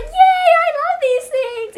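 put-on high, loud, slow toddler cheer; imitating a squealing, overjoyed child; excited two-year-old. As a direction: cheer like a tiny kid in bliss. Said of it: "She literally was like".

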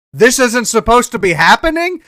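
high, loud disbelieving echo of the words; incredulous mocking echo. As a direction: throw his words back, outraged. Mocking friend's dad's words.

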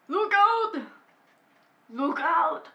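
shouted warning in a bad Austrian accent; Arnold Schwarzenegger. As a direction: shout it as an accented action star. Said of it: "I just can't do his accent".

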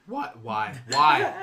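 rising loud disbelieving why; shocked disbelief. Real, not acted. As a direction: demand why, incredulous. Shocked by bee sting story.

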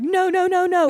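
high, loud panicked yell of no; screaming no. Retold or imitated. Friends in the crowd yelling at her to stop. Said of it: "we're screaming".

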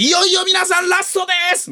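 high, loud emcee rallying cry; hyped announcement. Retold or imitated. MC hyping the audience at the entrance ceremony, high excited shout. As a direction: whip up the crowd for the finale. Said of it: "俺が振りで".

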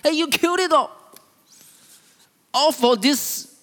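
put-on angry older son. Older son accusing his father over the brother, angrily. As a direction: protest loudly in a high, angry voice. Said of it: "He was so angry".

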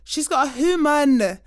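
slow, loud, every word spelt out; insistent repeat. Retold or imitated. Daughter repeating herself slowly and loudly to her mum. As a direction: repeat it firmly as if she's being slow.

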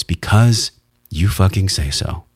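slow, weighted stress on "Because you fucking say so"; deliberate emphasis, each word of "fucking say so" landed; emphatic. Shown as a demonstration. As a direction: punch "Because", then spell out "you fucking say so" slowly. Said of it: "important to put emphasis on words".

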